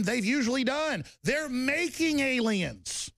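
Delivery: impassioned high-pitched tirade without extra volume; worked-up rant. Real, not acted. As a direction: stretch the key words in a rising, high rant. Rant building at opponents.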